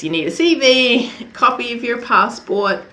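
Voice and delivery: ordinary talk, nothing marked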